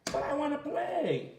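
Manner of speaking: put-on left-out child pleading with friends; disappointed kid. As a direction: whine a little like a left-out kid. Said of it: "you're like".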